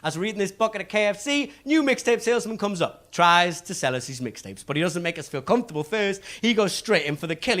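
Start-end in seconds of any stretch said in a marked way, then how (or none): none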